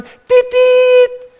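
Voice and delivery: high honking beep imitation; high horn honk. Brothers outside honking for Jesus, high horn beep.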